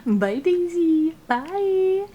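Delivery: high, slow sing-song goodbye to a dog; pet voice. Real, not acted. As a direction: coo it like talking to your puppy. Saying goodbye to sleeping dog.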